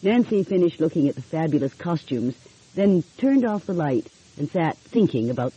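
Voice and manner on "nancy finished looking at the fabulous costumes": ordinary delivery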